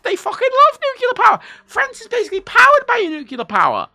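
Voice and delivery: very high, loud exclamation; emphatic enthusiasm. Real, not acted. Streamer insisting the French love nuclear, high excited emphasis. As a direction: voice leaps up to drive it home.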